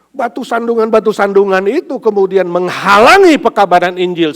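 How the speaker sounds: loud preaching. Acted. Preacher building to a loud point.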